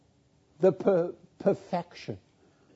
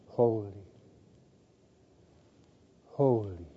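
low, soft awed utterance; hushed sigh; 8 semitones lower, 7 dB quieter than usual. Acted. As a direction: sigh it out low and softly, in reverence. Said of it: "and sigh to one another".

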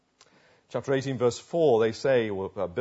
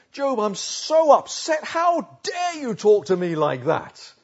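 high, slow, louder indignation; hammed-up how-dare-you affront; mock upset outrage; 6 semitones higher, 4 dB louder, slower than usual. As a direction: stretch out the offended protest.

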